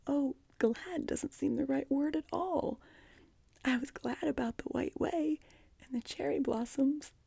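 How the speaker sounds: put-on high, overwhelmed little-girl voice; pitched well up, puzzled by happy tears; bewildered. A narrator's reading. Anne learns she can stay, bewildered and overjoyed. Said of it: "in a tone of bewilderment".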